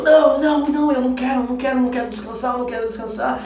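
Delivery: panicked, high and loud pleading no; desperate refusal. Retold or imitated. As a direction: beg not to rest, frantic and fast. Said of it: "eu ficava mais desesperado".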